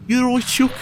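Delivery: put-on half-hearted Brummie accent at a high pitch; attempted Brummie accent. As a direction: try a Brummie accent and miss it. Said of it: "I can't even do a Brummie accent".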